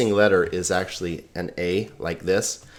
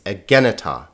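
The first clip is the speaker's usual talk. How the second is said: "egeneta" stressed on "gen"; stress on second syllable; 2 semitones lower than usual. Shown as a demonstration. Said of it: "the accent is over this syllable".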